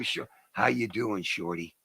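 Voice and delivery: low, soft gravel greeting; mock quiet Eastwood hello; Clint Eastwood. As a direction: drop low and quiet for the old star. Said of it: "'cause his voice".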